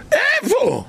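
high, squeaking disbelief; a sharp high yelp of where; incredulous cry. Acted. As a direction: toss the question up in amazement. Rhetorical exclamation about food.

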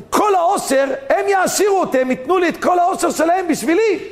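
high-pitched, excited claim on riches; worked-up, grasping delivery; fired-up scheming. Acted. Acts out Korach's greedy scheme.

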